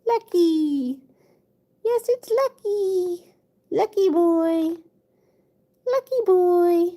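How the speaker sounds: slow, drawn-out cooing; slow pet coo. Real, not acted. Owner cooing her cat's name, slow and drawn out. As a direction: stretch his name out fondly.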